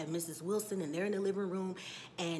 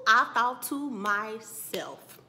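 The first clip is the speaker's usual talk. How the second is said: very slow and loud; drawn-out emphasis; 8 dB louder, much slower than usual. Shown as a demonstration. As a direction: stretch each word out for effect. Drawn-out take before repeating it.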